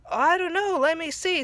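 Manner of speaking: cartoon character imitated. Character quote, retold episode.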